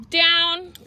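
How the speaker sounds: high, loud "Down." barked at the dog; sharp command. Real, not acted. Trainer barking Down at her Frenchie, loud and high.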